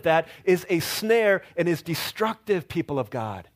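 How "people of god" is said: ordinary delivery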